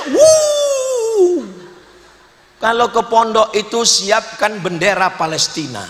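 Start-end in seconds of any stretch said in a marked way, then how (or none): 0.1-1.4 high drawn-out "wuuh" sob imitation; hammed-up crying howl, very high; mock wail. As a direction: wail like a homesick parent, exaggerated. Ngelihat kasurnya.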